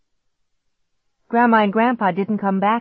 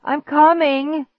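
high, slow, drawn-out call; moaned shout; 10 semitones higher, 5 dB louder, slower than usual. A narrator's reading. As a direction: struggle to call out, stretching the words. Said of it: "my voice came out in a moan".

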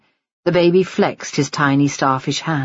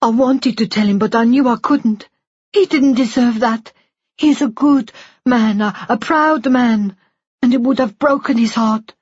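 put-on higher, tearful older woman pleading; Missus Shinwell voice; 8 semitones higher, 3 dB louder than usual. Woman confessing to the nurse, high and tearful. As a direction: voice her high and aching with guilt.

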